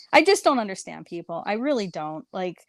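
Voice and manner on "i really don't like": usual speaking voice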